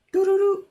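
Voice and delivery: high, loud sing-song dial-tone hum; hummed ringing. Retold or imitated. Waiting on hold for PlayStation, a high hummed ring tone. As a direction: hum the phone ringing while you wait.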